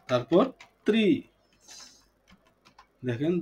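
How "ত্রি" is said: "ত্রি" as a separate syllable; syllable breakdown. Shown as a demonstration. Teacher naming the last piece for the class, "ত্রি" said as its own syllable. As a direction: set "ত্রি" apart as the last beat. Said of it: "ধীরে ধীরে উচ্চারণ করব".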